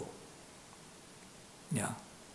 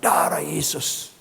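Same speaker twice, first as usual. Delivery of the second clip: loud, slow, raised invocation; mother's cry; 4 semitones higher, 7 dB louder, much slower than usual. Retold or imitated. Mother driving the devil away, loud invocation. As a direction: call it out forcefully, stretching the words. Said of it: "mamanya bilang".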